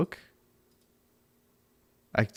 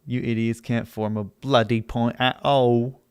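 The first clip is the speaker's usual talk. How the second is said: ordinary delivery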